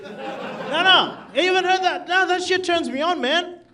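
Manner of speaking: ordinary talk, nothing marked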